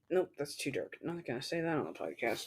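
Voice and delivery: low, soft self-censoring aside; muttered aside. Real, not acted. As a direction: drop it quietly, backing off. Backing off a dark joke.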